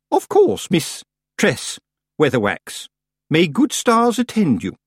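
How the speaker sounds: high, affected courtesy; icily polite farewell. A narrator's reading. Missus Earwig bids Granny farewell, icily polite. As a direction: break up "Miss Tress" pointedly.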